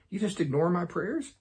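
high-pitched, incredulous mock question; accusing God. Teacher acting out his old complaint about ignored prayers, accusing. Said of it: "when I had those same kind of thoughts".

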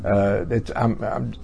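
normal talking voice

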